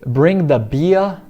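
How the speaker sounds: British RP demo; BBC English accent. Shown as a demonstration. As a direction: bring the beer with no r in beer, slow. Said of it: "em inglês já estilo B B C seria".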